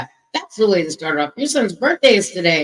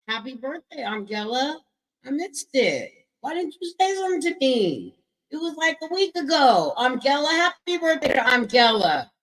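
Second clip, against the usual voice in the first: normal talking voice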